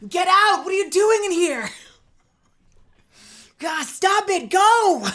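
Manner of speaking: put-on high, loud mortified teen boy; hollow teen boy yelling. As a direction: yell the intruder out of the room. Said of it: "that hollow voice".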